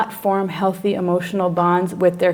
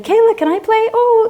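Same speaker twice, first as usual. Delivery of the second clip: put-on very high child-directed voice; coaxing a child; 4 dB louder than usual. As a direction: pipe up as if joining a child's game.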